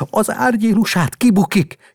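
high, loud alarmed shout; a father yelling as his son falls; father's cry. A narrator's reading. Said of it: "kiáltott az apa". (rémült kiáltás)

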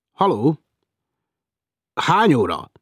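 a loud, cheeky hail; called out. Acted. Hailing the lift boy, loud and playful. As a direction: call it out across the corridor. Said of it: "kiáltja rá".

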